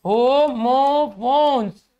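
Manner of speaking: होमोफोन्स drawn out very slowly, each syllable spelled out; slow careful pronunciation. Shown as a demonstration. Teacher showing students how to say होमोफोन्स, slowly. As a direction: stretch होमोफोन्स long and clear for the class. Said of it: "होमोफोन्स इस तरह से बोलते हैं".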